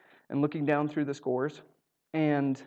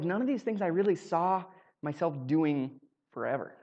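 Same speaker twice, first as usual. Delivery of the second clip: normal talking voice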